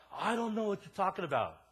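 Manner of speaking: loud, high, distraught mock wail; high-pitched retold outburst of helpless protest; imitated crying. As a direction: plead in upset, acting out her tears. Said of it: "she started crying".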